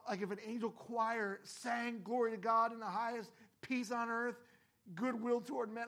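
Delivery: loud, high, impassioned preaching; preaching at full force. Acted. Pastor to congregation, loud impassioned preaching.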